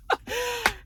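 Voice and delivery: a high burst of laughter. Retold or imitated. Laughing along at Giselle's line, high cackle. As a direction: cackle as if caught up in the fun. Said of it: "was cracking me up".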